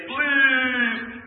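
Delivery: "please" high, loud and drawn out; whiny please. Shown as a demonstration. Preacher showing how not to ask God, a whiny please. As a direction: whine the word "please" like a sulking child. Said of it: "in a whiny, unpleasing way".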